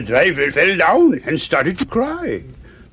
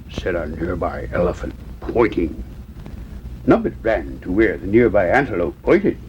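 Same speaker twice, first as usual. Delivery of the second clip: ordinary delivery, nothing marked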